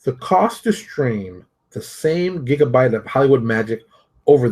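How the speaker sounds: measured, deliberate article read; reading aloud. Acted. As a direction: read it out like a quoted passage.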